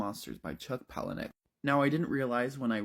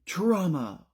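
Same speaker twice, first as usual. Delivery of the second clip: high, punchy photographer's commands; demanding photographer; 6 semitones higher, 3 dB louder, slower than usual. Acted. Imagined photographer yelling at the model, mock-dramatic shouts. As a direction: call out poses like a fashion photographer, higher and louder. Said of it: "my photographer would be yelling certain things at me".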